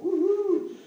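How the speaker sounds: high, loud "woohoo"; joyful whoop. Real, not acted. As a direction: cheer like a fan. Cheering the Bible answer.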